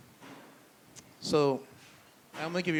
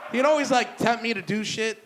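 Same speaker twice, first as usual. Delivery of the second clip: laughing; 6 semitones higher, 6 dB louder than usual. Real, not acted. Laughing through words.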